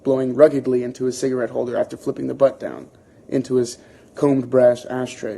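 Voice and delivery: ordinary delivery, nothing marked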